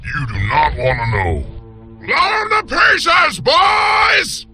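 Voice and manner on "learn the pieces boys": high, drawn-out holler to the gang; rally your men with a long yell; shouted battle order. Acted. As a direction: outlaw boss hollering a command. Gang boss orders an attack.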